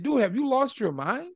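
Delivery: high, disbelieving challenge; dad confronting his son over the spending; incredulous. Retold or imitated. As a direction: ask like he's gone crazy.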